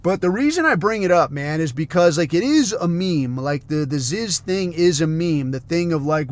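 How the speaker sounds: normal talking voice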